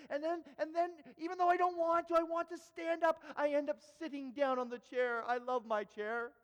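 high, urgent, anguished pleading; impassioned confession. Retold or imitated. Preacher admits the chair keeps calling him, impassioned. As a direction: confess the temptation as if it overpowers you.

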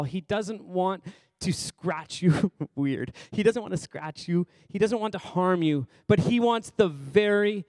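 building, insistent sermon delivery; emphatic preaching. Real, not acted. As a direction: preach it, drawing out the key words.

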